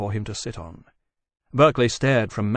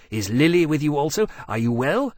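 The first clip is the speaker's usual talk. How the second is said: eager, raised-voice questions; joyful; 4 semitones higher, 4 dB louder than usual. A narrator's reading. Temeraire asking after his arriving friends, joyful. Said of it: "Temeraire cried joyfully".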